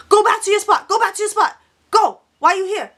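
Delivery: put-on high-pitched yelling in a Chinese accent; heavy Chinese accent. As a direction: mimic an irate lady with a thick Chinese accent. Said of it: "very heavy Chinese accent".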